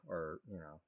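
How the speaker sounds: ordinary talk, nothing marked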